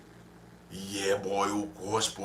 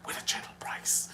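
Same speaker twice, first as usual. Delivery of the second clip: ad slogan whispered; whispered ad line; 10 dB quieter than usual. Retold or imitated. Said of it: "The advertisement used to go so".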